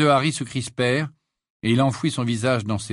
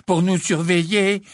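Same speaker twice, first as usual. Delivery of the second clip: mock fearful; 8 semitones higher, 5 dB louder than usual. A narrator's reading. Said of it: "faisait mine de trembler".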